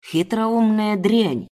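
slow, drawn-out insult; angry cursing. Acted. Kidnapper cursing untied captive, angry and slow. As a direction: curse her with venom, stretched out. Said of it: "Выругавшись".

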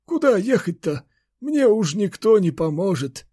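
put-on high old man's voice, resigned; grandfather. A narrator's reading. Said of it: "покачал он головой". (безнадёжный высокий стариковский голос)

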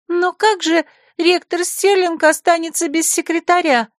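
put-on high, doubtful girlish question; doubting. Secretary hesitates before Anoria, high and doubtful. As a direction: wonder aloud, up high. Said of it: "засомневалась Лира Грин".

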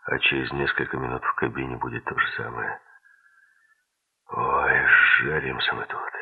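low, mildly weary grumble about heat; weary sigh. A narrator's reading. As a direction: keep it low, a touch of a sigh. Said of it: "вздохнул он".

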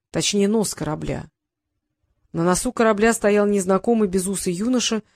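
ordinary delivery

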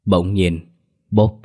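plain reading voice

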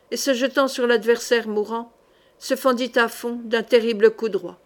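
plain reading voice

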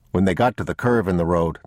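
ordinary delivery, nothing marked

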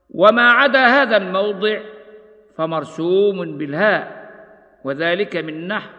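ordinary delivery

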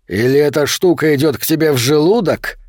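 usual speaking voice

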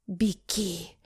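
soft exhaled urgent word; breathed out. A narrator's reading. Muromtsev urging Masha to flee, breathed out softly. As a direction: breathe the word out, barely voiced. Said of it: "выдохнул Муромцев".

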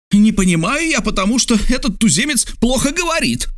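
high, sharper, annoyed; growing irritation. A narrator's reading. Geographer annoyed, blaming the native's poor speech. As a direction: let irritation creep in. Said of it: "начиная раздражаться".

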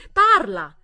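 a single loud, hysterical command; hysterical shout. A narrator's reading. Tearful daughter screaming at her silent mother, hysterical shout. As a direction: hurl the word at her. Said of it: "era isterica e decisa".

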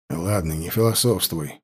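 low, soft grumble; grumbling. A narrator's reading. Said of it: "проворчал Вадим".

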